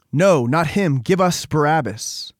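narrated mob demanding cruelly; hateful crowd demand. A narrator's reading. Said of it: "suspended by cruelty and hatred and spite".

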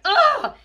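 loud, high grunt of disgust; a loud, high, frustrated ugh; angry ugh. Real, not acted. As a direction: let out a fed-up groan. Angered by the video.

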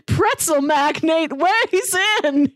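ordinary talk, nothing marked

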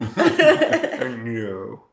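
weaker, hammed-up second impression; Alan Rickman. Shown as a demonstration. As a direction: botch the actor's voice through laughter. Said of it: "it was better the first time".

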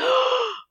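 sharp high gasp; surprised gasp. Real, not acted. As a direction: gasp in shock at the kill. Enemy falls dead, table reacts.

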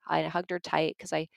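normal talking voice

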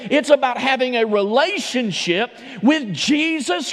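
rising Southern preacher's fervor; preaching. Acted. Pastor preaching to his church, full fervor. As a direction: build the message toward the altar call.

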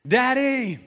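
high, loud drawn-out call; imitated child's yell. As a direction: yell like a little girl calling across a room. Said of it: "yelling across all her friends".

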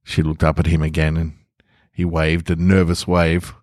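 ordinary delivery, nothing marked

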